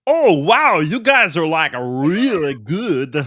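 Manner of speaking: high, loud, wild over-the-top foreigner; cartoonishly enthusiastic comic accent, stretched words; generic-accent comedy character. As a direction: gush in a high, silly made-up accent. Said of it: "it was a comedy character".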